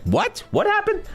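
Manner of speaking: high, startled mom asking what happened; shocked mom. Retold or imitated. Son tells mom the old story, she reacts with high shock. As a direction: gasp it out as his shocked mother.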